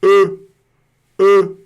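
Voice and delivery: E high and loud with rounded lips. Shown as a demonstration. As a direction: project the rounded E. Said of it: "julgar esse E".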